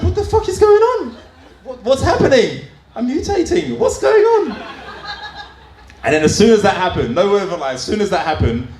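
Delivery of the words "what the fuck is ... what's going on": high, panicked squeal of alarm; freaked-out panic. Retold or imitated. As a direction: shriek like you're mutating. Panicked shock at discharge.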